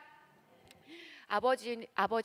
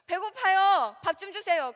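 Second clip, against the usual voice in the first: put-on higher, louder childlike asking; hungry child asking; 8 semitones higher, 7 dB louder than usual. Child asks parents for food: high childlike whine. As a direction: whine for a meal like a hungry kid.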